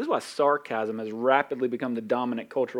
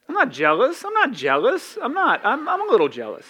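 mock jealous denial; 9 semitones higher, 5 dB louder than usual. Mock reply of a jealous listener.

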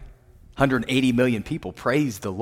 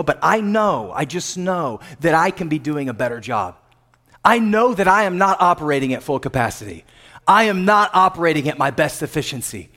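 ordinary delivery

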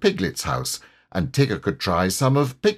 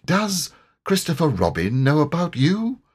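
higher-pitched character question; a touch of character voice, pitched up; lightly voiced storybook bear; 8 semitones higher than usual. A narrator's reading. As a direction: ask it as a puzzled storybook bear. Pooh questions Tigger in story.

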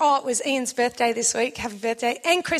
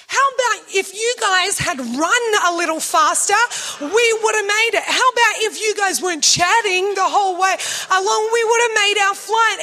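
higher, louder indignant retort; snippy rant; 5 semitones higher, 4 dB louder than usual. Retold or imitated. Wife blaming husband after missing the flight, indignant. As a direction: fire back at him with attitude. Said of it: "filthy attitude".

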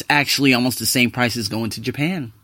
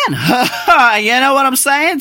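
slow, laughing question; 9 semitones higher, 4 dB louder, much slower than usual. Real, not acted. Vlogger laughing to his listeners. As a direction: laugh through the words.

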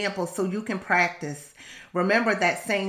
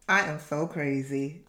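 normal talking voice

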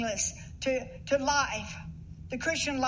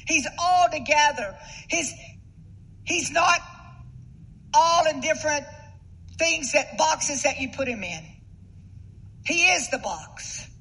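loud, rising preacher's fervour; preaching at full force; 3 semitones higher, 5 dB louder than usual. Acted. Pastor exhorting the congregation about God's breath, fervent and loud. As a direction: drive the point home from the pulpit.

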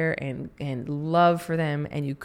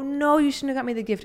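higher, fast mock protest; refusing friend; 9 semitones higher, faster than usual. Friend turning down the gift, higher mock protest. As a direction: brush off the gift playfully. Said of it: "And they go".

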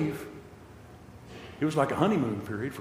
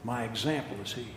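normal talking voice

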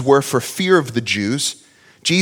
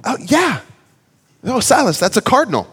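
put-on higher, bright sing-song to a child; excited dad; 7 semitones higher, faster than usual. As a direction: cheer the kid on. Dad answering his son enthusiastically.

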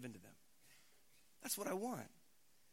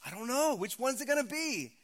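a higher, louder, hammed-up fretting God; mock panicked God; 9 semitones higher, 9 dB louder than usual. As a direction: play God as a nervous onlooker, mock-anxious. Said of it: "God is, like".

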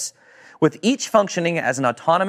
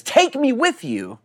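much higher, slow pleading voice; begging man; 9 semitones higher, slower than usual. Retold or imitated. Healed man begging Jesus to take him along. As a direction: plead like someone desperate to follow. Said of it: "He begs Jesus".

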